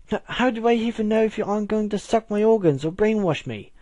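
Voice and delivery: high, stammering British suspicion; fearful accusation in a put-on British accent; frightened British hiss. A narrator's reading. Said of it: "Octavia hissed from behind the couch".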